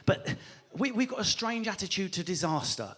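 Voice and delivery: ordinary talk, nothing marked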